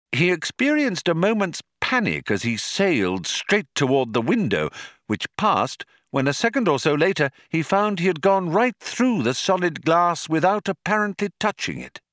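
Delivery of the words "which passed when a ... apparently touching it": plain reading voice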